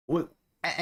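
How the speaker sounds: usual speaking voice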